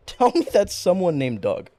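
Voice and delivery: laughing, pleading repeat; laughing plea. Real, not acted. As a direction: beg through laughter.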